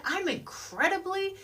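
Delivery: "incredibly" punched high with relish; oomph on "incredibly". Shown as a demonstration. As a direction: lift and lean hard into "incredibly". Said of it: "it gives it that oomph".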